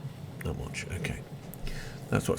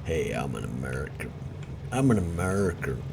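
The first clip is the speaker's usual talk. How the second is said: lightly put-on American accent, lower; mock American accent; 6 semitones lower, 4 dB quieter than usual. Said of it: "it sounded American".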